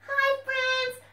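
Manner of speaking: high, loud puppet greeting; puppet fox voice. Acted. Puppet fox greets the children, high and loud. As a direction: sing out hi like a puppet.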